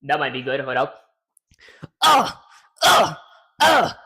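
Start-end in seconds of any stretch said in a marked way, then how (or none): 2.0-3.9 loud high attack grunts; aggressive grunts. Shown as a demonstration. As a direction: snarl out each hit. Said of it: "Go with the aggression".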